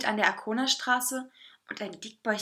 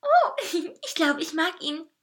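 high, flattered giggle; giggly, high cake voice; giggling; 8 semitones higher, 4 dB louder than usual. A narrator's reading. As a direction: giggle through the words. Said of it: "kicherte der Kuchen".

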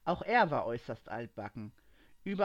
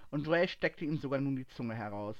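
ordinary delivery

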